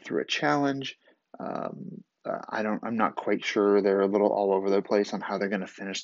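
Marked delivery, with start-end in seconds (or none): none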